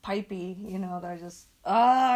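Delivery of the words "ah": frustrated groan. Real, not acted. Frustrated outburst about pain.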